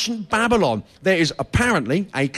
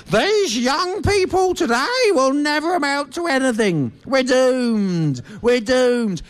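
put-on high, huffy old British fogey; doom-laden grumble of a crusty old colonel; crotchety old Brit; 6 semitones higher, slower than usual. Comic mimicking crusty post-Empire elders, huffy and high. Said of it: "post-Empire British people going".

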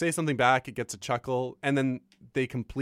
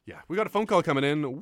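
ordinary talk, nothing marked

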